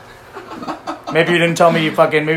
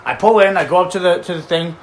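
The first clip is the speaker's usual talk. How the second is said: normal talking voice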